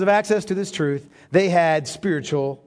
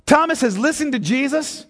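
ordinary talk

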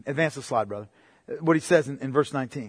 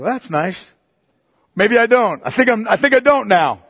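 lightly played cool dismissal; mock brush-off; 3 semitones higher, 4 dB louder than usual. The girlfriend dismissing his "I love you", mock brush-off. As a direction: hand back the love, unimpressed. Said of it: "that was her response".

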